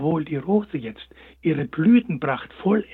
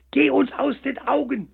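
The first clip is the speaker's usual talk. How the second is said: high, loud shout to leave; angry shout; 9 semitones higher, 4 dB louder than usual. A narrator's reading. The sunflowers yell at the hare to get lost. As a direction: chase him off. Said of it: "schrien sie zu ihm".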